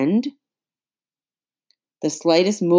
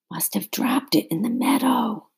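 breathy unvoiced whisper, a little higher; 5 semitones higher, 3 dB quieter than usual. A narrator's reading. Hiding girl telling her friend the bad news, whispered. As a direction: whisper it so no one hears. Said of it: "Hannah hissed back".

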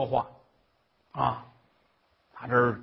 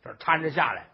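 plain reading voice

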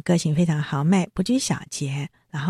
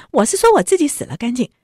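急切辩解; 7 semitones higher, 4 dB louder than usual. Retold or imitated. 宝玉急着辩解.

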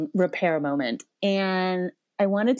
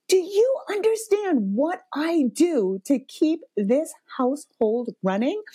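retold parental rage; 8 semitones higher than usual. Mom confronting her son about the household, enraged. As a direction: spell it out in exasperation, pitched up. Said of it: "like, parental rage".

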